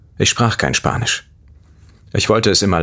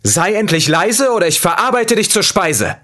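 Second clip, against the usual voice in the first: with all his courage; 14 semitones higher than usual. A narrator's reading. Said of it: "sagte mit all dem Mut".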